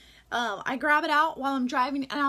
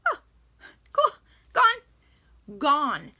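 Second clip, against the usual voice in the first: mock delighted relief; 2 semitones higher, 6 dB louder, much slower than usual. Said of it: "I'll be like".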